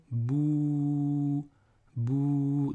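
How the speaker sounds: "bou" held very long on a level note; long vowel. Shown as a demonstration. Teacher showing the long vowel, "bou" drawn out. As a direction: stretch the ou of "bou" steadily. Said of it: "c'est une voyelle longue".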